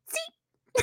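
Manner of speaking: tiny, very high squeak of a sneeze; imitated tiny sneeze. Host showing how she sneezes like her mother, tiny mock sneeze. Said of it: "mine are like my mother's".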